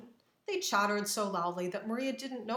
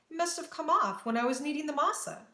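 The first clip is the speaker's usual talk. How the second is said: higher-pitched, worried realization; anxious inner thought; 6 semitones higher, 3 dB louder than usual. A narrator's reading. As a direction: think it through in rising alarm. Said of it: "she thought to herself".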